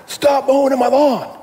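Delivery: high, strained mock shout from the grumpy neighbor; mock yell. Neighbor yelling at the pastor over the lawn, mock yell. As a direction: bark it back like a cranky old man. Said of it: "And he yells back".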